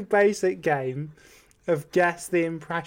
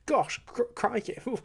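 posh English exclamation; 5 semitones higher, 6 dB quieter than usual. Acted. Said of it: "I'm posh. I'm English".

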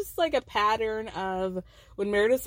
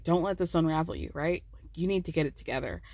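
lower, firm advice; lightly voiced Mary advising; 6 semitones lower, 3 dB quieter than usual. Mary coaching Meredith to get it together, lower. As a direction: talk her down, lower.

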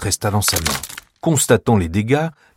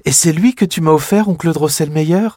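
put-on high, louder wondering child; little girl voice; 8 semitones higher, 4 dB louder than usual. Clara asks her uncle about her gift, amazed.

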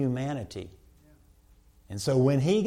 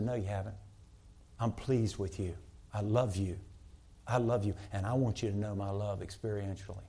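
softer, lower reassuring words; God's tender voice; 4 semitones lower, 5 dB quieter than usual. Retold or imitated. Preacher voicing God reassuring a discouraged listener, soft and tender. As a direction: speak it gently as God comforting. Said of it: "And God said".

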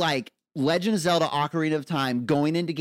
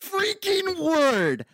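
slow, drawn-out, much higher exclamation; thrilled gasp; 12 semitones higher, much slower than usual. Real, not acted. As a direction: stretch it out in pure delight. Thrilled by the Loki finale.

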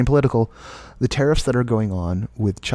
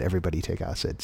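softer, faster, embarrassed; quiet sheepish repeat; 3 semitones lower, 8 dB quieter, faster than usual. Retold or imitated. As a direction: mumble it back sheepishly. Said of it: "I just said".

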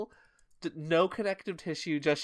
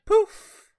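poof sound; 11 dB louder than usual. Pretend. Sound effect noise.

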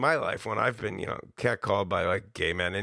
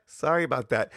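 sheepish laughing apology; laughing sorry; 5 semitones higher than usual. Real, not acted. Host laughing off an awkward remark, chuckling.

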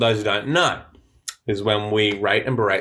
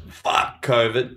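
drawn out slowly, for effect; slow catchphrase toast; much slower than usual. Acted. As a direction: savour each word like a toast. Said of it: "And I'll just go".

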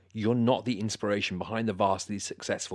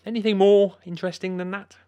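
exasperated; 11 semitones higher, 9 dB louder than usual. Acted. Host loses patience.

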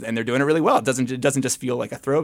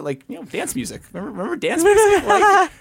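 exasperated; 5 dB louder than usual. Real, not acted. Exasperated plea about dance music.

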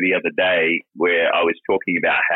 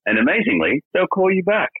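voicemail greeting; 4 semitones higher than usual. Pretend. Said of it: "Let me start again".

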